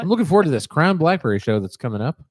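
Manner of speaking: fast, upbeat promo read. Acted. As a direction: sell it like a sponsor spot. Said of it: "Is that where I'm supposed to say this".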